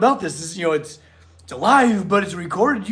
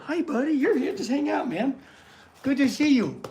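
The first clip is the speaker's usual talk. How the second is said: much higher and softer, gentle greeting; 7 semitones higher, 4 dB quieter than usual. Real, not acted. Greeting the jumpy rescue dog, soft high cooing. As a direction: coo hello to the dog.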